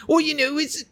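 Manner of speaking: high-pitched imitation of a British friend; mock British Sven. Host mimicking Sven's British terms, high mock voice. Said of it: "he'll whip out these weird British terms".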